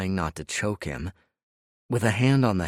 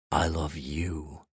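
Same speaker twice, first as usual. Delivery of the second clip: slow tender whisper-soft repeat; 3 semitones lower, 4 dB quieter, slower than usual. Acted. Repeated, softer and slower.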